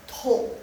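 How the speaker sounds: "to" as tou, dipping then rising; third tone. Shown as a demonstration. Teacher contrasts four tones, dipping third. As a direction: drop "to" down, then lift it. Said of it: "Nada ketiga".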